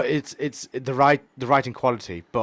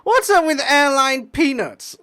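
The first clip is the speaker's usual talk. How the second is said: high slow whiny comic impression; nasal whiny stand-up delivery; Seinfeld voice; 11 dB louder, slower than usual. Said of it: "nasal sort of whiny voice".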